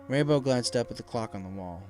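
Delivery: ordinary delivery, nothing marked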